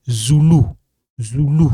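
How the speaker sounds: "Zulu" as ZOO-loo, both vowels back oo; African name. Shown as a demonstration. Teacher quoting what South Africans say, "Zulu" as zoo-loo. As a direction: say "Zulu" with two rounded oo sounds. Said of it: "Because in African name".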